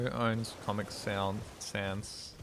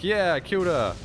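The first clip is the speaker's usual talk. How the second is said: proud boast; 7 semitones higher, 8 dB louder than usual. Retold or imitated. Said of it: "And I was like".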